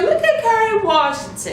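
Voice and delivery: high gushing exclamation; excited. Retold or imitated. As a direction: squeal it out in delight. Excited reaction to actress.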